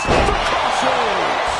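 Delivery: slow drawn-out shouted call; move call shout. Real, not acted. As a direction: bellow the move name. Commentator calls finishing move.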